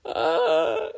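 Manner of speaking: loud, high flustered shriek; embarrassed yelp. A narrator's reading. Izuku realizes he landed on a girl, yelping. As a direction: yelp in panic.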